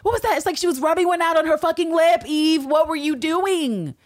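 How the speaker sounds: very high, incredulous mock scolding; scandalized outburst. Host calling out Eve for caressing her lip, scandalized. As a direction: cry out in hammed-up disbelief.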